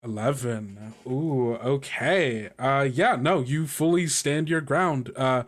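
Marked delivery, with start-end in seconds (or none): none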